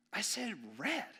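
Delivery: a touch of a grandma's reproach; stern grandma. Retold or imitated. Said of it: "she says".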